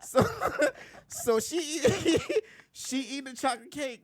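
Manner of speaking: words squeezed out through giggles, pitched high; laughing while talking. Real, not acted. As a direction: keep giggling as you talk. Laughing while telling the story.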